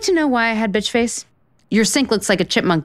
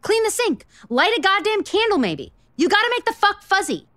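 exasperated lecture; 10 semitones higher than usual. Acted. Lecturing him after bad sex.

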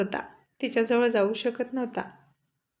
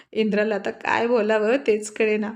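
plain reading voice

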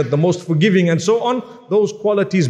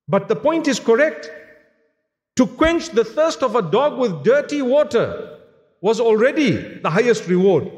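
higher, driving preacher's voice; rising sermon; 6 semitones higher than usual. Acted. Preacher urging his congregation, higher and driving. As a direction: press the point home to the congregation.

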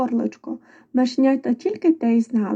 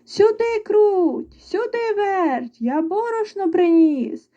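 high, drawn-out summons; calling the mice; 8 semitones higher, slower than usual. A narrator's reading. Rooster back with flour, calling out. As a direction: sing out the names to bring them over. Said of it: "знов кличе мишенят".

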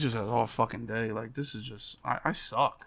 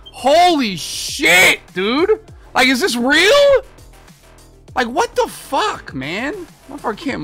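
high, loud, stretched-out disbelief; disbelieving high yell; 13 semitones higher, 9 dB louder, slower than usual. Real, not acted. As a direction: cry out in disbelief. Disbelief at a failing offense.

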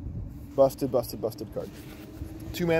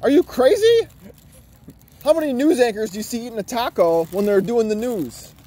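mock outrage; 9 semitones higher, 6 dB louder than usual. Mock outrage at eating mid-news.

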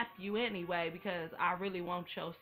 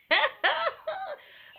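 high shrieking laugh; 6 dB louder than usual. Retold or imitated. Imitating Chanel cracking up, a high shriek of laughter.

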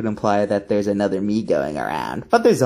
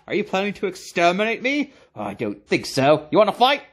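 ordinary talk, nothing marked

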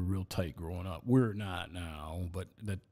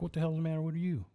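alarmed; 7 semitones higher, faster than usual. Retold or imitated. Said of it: "I'm like".

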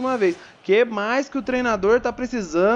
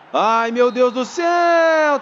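dismayed exclamation; 6 semitones higher, 4 dB louder, much slower than usual. Real, not acted. Offside disappointment exclamation.

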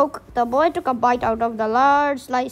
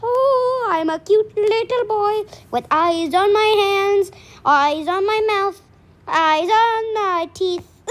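higher, drawn-out pretend-sweet voice; cute little boy; 4 semitones higher than usual. Reader pretending to be a little boy, put-on cute. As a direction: croon it high, stretching the ends.

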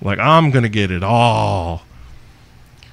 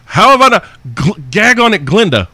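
excited; 8 semitones higher, 4 dB louder than usual. Real, not acted. Thrilled by listener's idea.

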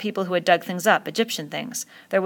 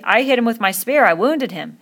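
loud, indignant insistence; 5 semitones higher, 6 dB louder than usual. A narrator's reading. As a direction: insist loudly that you be believed. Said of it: "said Ralph indignantly".

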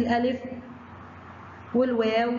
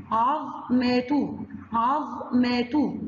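عظمة drawn out slowly, each syllable held; slow syllable-by-syllable reading; slow stretched syllables; much slower than usual. Shown as a demonstration. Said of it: "ظا سكون".

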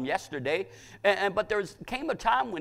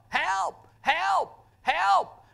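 very high, repeated calls; imitated cries for help; 4 dB louder, much slower than usual. Preacher imitating blind men crying for help, high calls. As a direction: call out high, one word at a time. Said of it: "They got so loud crying for help".

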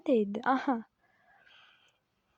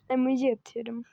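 usual speaking voice

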